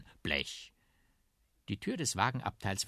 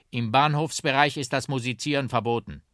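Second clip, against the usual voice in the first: raised, louder official voice; officious policeman; 7 semitones higher, 7 dB louder than usual. Acted. Policeman stops two instruments at the station, officious raised voice. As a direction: lay down the rule loudly.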